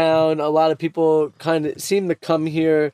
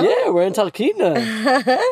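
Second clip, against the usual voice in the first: laughing; 9 semitones higher, slower than usual. Real, not acted. Laughing while naming the town.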